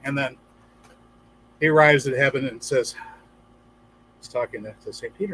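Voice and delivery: normal talking voice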